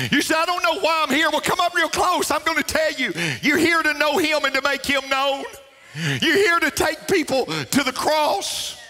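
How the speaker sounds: high-pitched, driving pulpit exhortation; fervent preaching. Real, not acted. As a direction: preach it up high, urging the congregation on.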